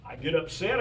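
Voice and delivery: ordinary talk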